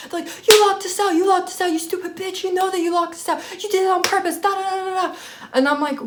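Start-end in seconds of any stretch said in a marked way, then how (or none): 0.5-5.1 very high-pitched imitation of furious roommates; mock screaming. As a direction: mimic their angry rant, pitched way up. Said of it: "they're screaming at me".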